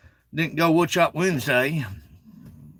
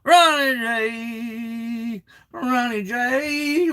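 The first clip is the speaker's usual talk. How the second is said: slow, high drawn-out name call; 7 semitones higher, much slower than usual. Real, not acted. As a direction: stretch the name out warmly. Calling out to a friend.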